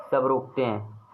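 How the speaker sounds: ordinary talk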